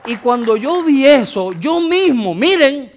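loud, rising sermon climax; preaching at full force. Acted. Predica con fuerza creciente. (predicación a todo pulmón, más aguda y fuerte)

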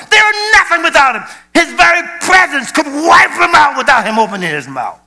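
high, loud shouting sermon; preaching at full force. Acted. Preacher shouting God's power over darkness, high and loud. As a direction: proclaim God's might with a shout.

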